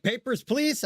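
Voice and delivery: high-pitched mock demand for papers; mock border guard. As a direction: squeak it out like a cartoon checkpoint guard. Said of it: "I would have been like".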